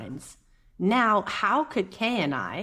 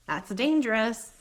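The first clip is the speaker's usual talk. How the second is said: ordinary delivery, nothing marked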